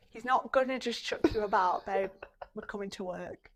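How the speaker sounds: ordinary delivery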